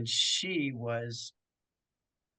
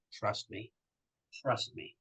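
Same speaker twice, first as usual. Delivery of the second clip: softly reassuring; 4 semitones lower, 8 dB quieter than usual. Retold or imitated. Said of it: "hair woman just went".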